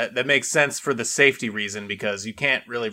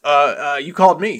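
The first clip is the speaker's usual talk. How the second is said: mock flustered; 6 dB louder than usual. Acting caller startled by dad's greeting.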